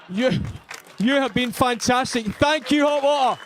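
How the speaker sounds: loud, high sign-off to the crowd; loud, excited farewell to the audience; big thank you. Real, not acted. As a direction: shout the thanks over the applause. Comic thanks audience at close.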